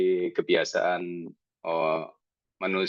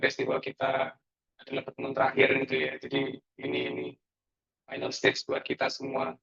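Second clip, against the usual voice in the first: robot voice; 5 semitones higher, 4 dB quieter than usual. Real, not acted. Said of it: "ini suaranya kayak robot Pak".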